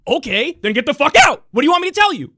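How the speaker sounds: yelling it out, pitch climbing high; furious shout at the party bosses; angry shout. Real, not acted. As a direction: tell them off at full volume. Furious rant at party leaders.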